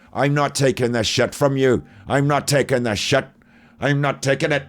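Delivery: mock furious rant in a put-on accent; angry Jim impression. Acting out Jim furious at Luke for interrupting, hammed-up angry rant. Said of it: "if I'm doing his accent".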